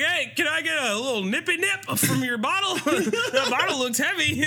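high, cracked ranting voice; crazy homeless man. Retold or imitated. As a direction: rave like an unhinged drunk. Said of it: "he's like".

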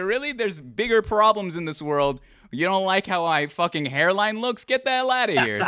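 brushing off online critics of his hairline, mock exasperated; mock exasperation. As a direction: scoff at the haters, hammed up. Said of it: "I'd be like".

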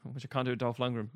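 ordinary talk, nothing marked

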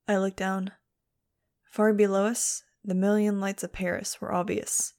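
usual speaking voice

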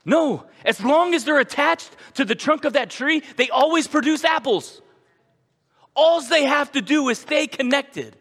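loud, high, ringing proclamation; preaching at full force. Real, not acted. Preacher to congregation, loud high proclamation. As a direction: hammer home the point, loud and high.

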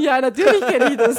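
amused protest, laughing out loud. Real, not acted. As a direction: giggle through the words. Laughing reply to teasing.

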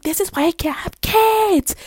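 high, loud, drawn-out whine; retold meltdown, climbing high on the last word; exasperated wail. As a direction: wail it in hammed-up despair. Retells own exasperated outburst.

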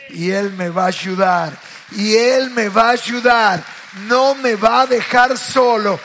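loud, rising sermon cry; preaching at full force. Acted. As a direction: lift the church up. Preaching climax. (predicación a todo pulmón)

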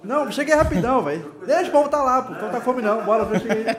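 high-pitched hype calling out; rally him over like a gym buddy; gym bro. Real, not acted. Urging courier to the mic.

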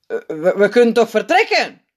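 loud, stammering worried voice; ongerust. A narrator's reading. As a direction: blurt it out uneasily, rising. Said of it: "klinkt een ongeruste stem". (bezorgd hakkelend)

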